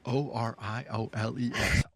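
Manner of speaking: letter by letter, O R I O L E S; spelling out the team name, deliberate; spelled out. Shown as a demonstration. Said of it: "It's my accent".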